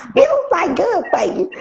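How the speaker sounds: laughing it out high; cracking up while saying it. Real, not acted. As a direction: repeat it through a helpless laugh. Repeats while laughing hard.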